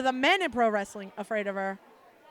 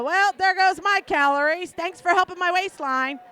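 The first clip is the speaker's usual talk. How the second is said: higher, loud mock complaint; loud sarcastic retort; 5 semitones higher, 6 dB louder than usual. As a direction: fake offended thanks. Retold phone banter, sarcastic reply.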